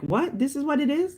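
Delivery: high, incredulous "what, this is it?"; disbelieving surprise. Retold or imitated. Newcomers arriving, shocked by what they find. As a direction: act let down and amazed at once. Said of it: "they're like".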